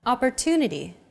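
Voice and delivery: "Opportunity" with a longer, stressed TU. Shown as a demonstration. Teacher has learners listen again, TU stressed in "Opportunity". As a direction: make TU the longest syllable of "Opportunity". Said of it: "pronounced longer than all other syllables".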